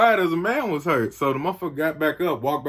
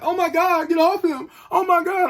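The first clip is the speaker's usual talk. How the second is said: very high, mock-frantic pleading; panicked onlooker; 4 dB louder than usual. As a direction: imitate a bystander wailing for the fight to stop. Imitates alarmed onlooker during fight.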